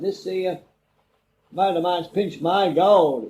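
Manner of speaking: mock outrage, dragging the words out; retold angry complaint. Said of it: "playing hell".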